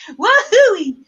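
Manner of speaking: loud, high cheer; excited whoop. Real, not acted. Host finally getting her whoop right, excited high cheer. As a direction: whoop it out triumphantly.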